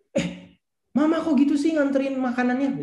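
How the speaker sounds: high-pitched, hammed-up outburst; mock yelling. Someone yelling at Mama over food delivery, hammed-up high yell. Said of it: "Dikit-dikit langsung teriak".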